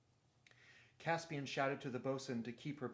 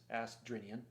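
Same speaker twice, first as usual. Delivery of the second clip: usual speaking voice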